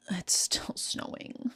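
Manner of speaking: slow hushed whisper. Real, not acted. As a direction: breathe it out slowly as an aside. Whispered aside about weather.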